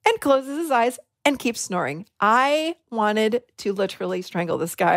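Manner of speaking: ordinary delivery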